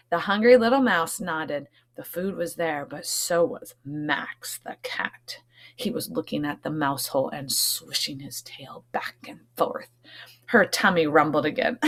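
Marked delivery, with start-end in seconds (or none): 1.9-9.9 hushed, breathy storytelling whisper; suspenseful whisper. A narrator's reading. Teacher whispering to the class about the watching cat. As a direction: whisper it so the cat won't hear.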